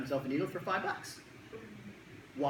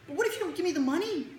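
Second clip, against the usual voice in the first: put-on wary kid; 11 semitones higher than usual. Kid doubting the promise.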